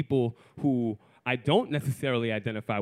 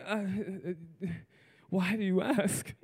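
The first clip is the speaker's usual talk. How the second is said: flustered stuttering; 4 semitones higher, 5 dB quieter than usual. Acted. Man with crossed wires answering her, halting flustered question. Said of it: "if I'm stuttering".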